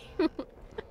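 a high breathless laugh at herself; laughing off the panic; breathy laugh. Real, not acted.

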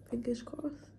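breathy whispered aside. Real, not acted. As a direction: whisper it hopefully. Hopeful aside, hushed.

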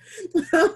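high, loud burst of laughter; bright laughing burst. Real, not acted. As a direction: laugh out in disbelief. Laughing at the thought of seven losses.

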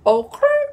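high, loud "Okay" with a failed R trill; attempted rolled R. Vlogger playfully trying a catchphrase, failed rolled R. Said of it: "I can't roll my R's".

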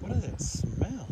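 grossed out, wrinkled nose; disgusted by smell. Real, not acted. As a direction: recoil from a foul stink. Reacts to nasty smell.

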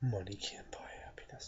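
breathy mock whisper of a cliché; hushed, scornful imitation of a saying. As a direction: whisper it like a sneering parody of what people say.

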